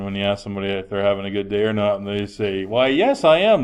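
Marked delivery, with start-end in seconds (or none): 2.6-3.6 sarcastic, rising high and louder on I am; mock-sweet sarcasm, pushing up at the end; sarcastic reply. Teen answering the teacher's good-day question, sarcastically. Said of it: "very sarcastically".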